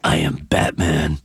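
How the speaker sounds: very low Batman growl; deep, rough superhero impression. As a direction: drop way down into a Batman voice. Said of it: "I was doing Batman's voice".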